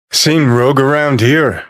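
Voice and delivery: ordinary delivery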